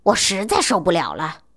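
usual speaking voice

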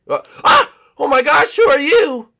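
loud high shocked cry; startled scream. Acted. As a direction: shriek in surprise. Startled by the mermaid.

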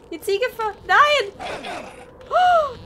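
high loud cry of alarm; alarmed shout. Real, not acted. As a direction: shout in fright. Goat attacks.

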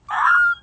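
high squealing laugh burst; shrieking laugh. Real, not acted. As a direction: let out a shrill laugh. Burst after her own line.

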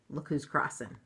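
usual speaking voice

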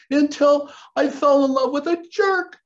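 high, loud hammed-up wail; exaggerated fake bawling; mock crying. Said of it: "And she starts crying".

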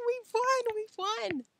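very high giggly squeal; gleeful triumph. A narrator's reading. Alan and Joe celebrating in the car, gleeful. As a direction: giggle and crow in victory. Boys celebrate winning the bet.

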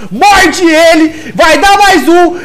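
rising hype play-by-play yell; excited shout. Real, not acted. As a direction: call the play at full volume. Live play-by-play hype call.

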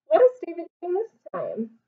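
plain reading voice

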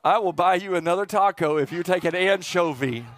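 ordinary talk, nothing marked

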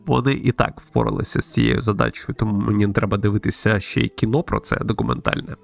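ordinary talk, nothing marked

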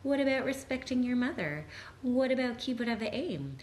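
high, mock-indignant relative scolding; guilt-tripping relative. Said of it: "You're gonna get the guilt trip".

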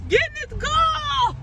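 high frantic yell; shouting. Real, not acted. To her friend outside the car, a high frantic shout. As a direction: order her in at full pitch.

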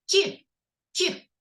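clipped phoneme "кі", said alone; isolated first sound. Shown as a demonstration. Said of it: "звук".

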